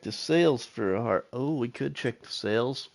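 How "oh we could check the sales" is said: ordinary talk, nothing marked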